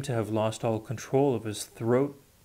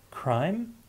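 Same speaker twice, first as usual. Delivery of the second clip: shocked; 8 semitones higher than usual. A narrator's reading. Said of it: "He heard the word".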